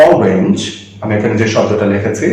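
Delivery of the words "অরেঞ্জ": অরেঞ্জ set apart, lower, opening on "aw"; low, careful অরেঞ্জ starting with "aw"; pronunciation demo. Shown as a demonstration. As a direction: model অরেঞ্জ for learners with an open first "o". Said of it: "একটু ভালো করে লক্ষ্য করো উচ্চারণটা".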